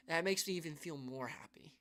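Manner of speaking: breaks into a whisper, holding back tears; choked whisper. Real, not acted. Whispering a tearful goodbye to his fans. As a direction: let the words fall to a tearful whisper.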